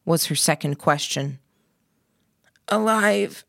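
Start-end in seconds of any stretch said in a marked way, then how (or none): 2.7-3.4 croaked. A narrator's reading. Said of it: "I croaked".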